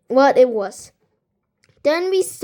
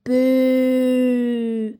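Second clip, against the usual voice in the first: put-on boys booing. A narrator's reading. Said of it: "said the boys".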